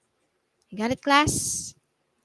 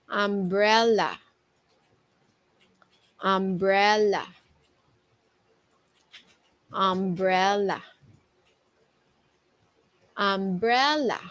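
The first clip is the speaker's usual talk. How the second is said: read faster. Shown as a demonstration. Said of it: "a little bit more faster".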